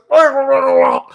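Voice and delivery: high, loud imitated puking noise; short hammed-up burst of fake vomiting; mock retching. Imitating his date throwing up, mock retching. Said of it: "throwing up, and she's like".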